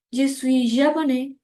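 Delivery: ordinary talk, nothing marked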